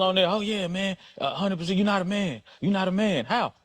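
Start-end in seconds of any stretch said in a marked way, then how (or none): none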